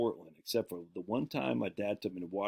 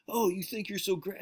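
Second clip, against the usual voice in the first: lightly voiced high sneering bully; a hint of a sneering junior; mocking bully; 11 semitones higher than usual. Tall junior taunting the new kid, lightly voiced high sneer. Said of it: "He goes".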